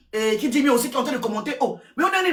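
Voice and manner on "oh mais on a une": surprised outburst. Real, not acted. Outraged reaction to the show.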